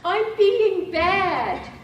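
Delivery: put-on very high, slow childlike confession; mother's voice. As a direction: stretch it out high like a guilty child. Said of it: "then she says".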